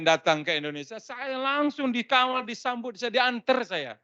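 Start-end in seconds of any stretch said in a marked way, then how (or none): none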